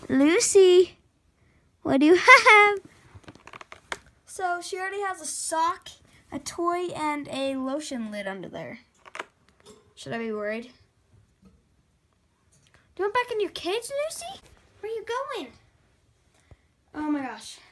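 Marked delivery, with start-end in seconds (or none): 0.1-2.8 loud, high-pitched call; raised voice, laughing a little; calling to pet. Real, not acted. Calling ferret caught with stash. 12.9-15.5 very high cooing questions; pet talk. Real, not acted. Coaxing ferret toward its cage.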